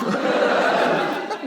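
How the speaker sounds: high breathless laugh. Real, not acted. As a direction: burst out in airy laughter. Laugh burst.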